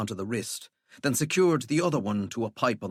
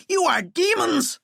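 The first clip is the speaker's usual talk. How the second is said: high frantic cry; 5 dB louder than usual. Acted. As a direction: cry out in terror. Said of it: "Walter cried".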